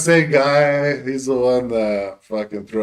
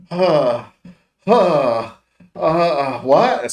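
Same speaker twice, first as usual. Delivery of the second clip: baffled; 4 semitones higher, 3 dB louder, much slower than usual. Retold or imitated. Imitates confused high friend.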